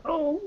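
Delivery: high-pitched pitiful whimper; small, very high acted whimper; awful whimper. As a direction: whimper feebly on a high note. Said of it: "awful whimpering kind of sound".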